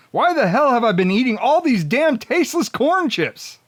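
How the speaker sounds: ordinary talk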